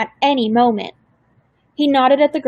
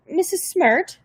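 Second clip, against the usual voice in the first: higher, gentle questioning call; a new voice cutting in politely; old woman calling; 7 semitones higher, slower than usual. A narrator's reading. Grandmother interrupting the caseworker's threat, a higher enquiring call.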